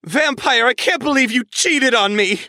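high, shrill, tearful accusation; shrill sobbing outrage. Acted. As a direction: wail it like a betrayed girlfriend. Ebony accuses Vampire of cheating.

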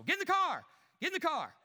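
hustle the family out the door; impatient calling. Acted. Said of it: "my thespian ability".

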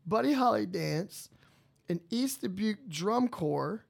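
slow, drawn-out local radio ad read; mock event announcement. Host plugging a dance to listeners, slow ad voice. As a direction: announce it like a small-town ad.

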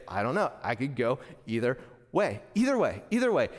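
"either way" repeated, trailing off lightly; lightly voiced shrugging buddy; friend's catchphrase. Pastor mimicking his hunting partner, mild shrug. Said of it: "He'll say".

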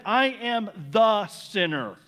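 "I am the sinner" slow, "I" weighted; slow stress on "I". Acted. Preacher voicing the man owning his guilt, slow and stressed. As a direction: own it, slowly, word by word. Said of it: "And so he says".

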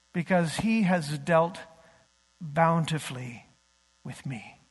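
slow, solemn psalm reading, sinking to a hush at the end; scripture reading. Acted. Pastor reading the psalm to the congregation, solemn and slow. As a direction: read the lament slowly and gravely. Reads psalm aloud in worship.

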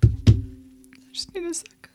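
whispered through tears; tearful whisper. Real, not acted. Asking listeners for a moment, whispering through tears. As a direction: breathe it out, holding back sobs. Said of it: "I don't wanna ruin my makeup".

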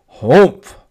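loud dismissive grunt; grumpy humph. Acted. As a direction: huff it out like a sulky camel. Camel refuses the horse.

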